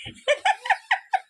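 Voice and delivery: a shrill, giddy burst of laughter; high squealing laugh. Real, not acted. Streamer laughing at the reading, high squealing laugh.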